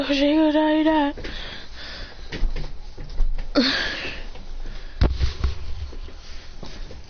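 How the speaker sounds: long, quiet wheezing laugh; real laughing fit. Real, not acted. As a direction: crack up helplessly, almost voiceless. Cracks up at Naruto Uchiha.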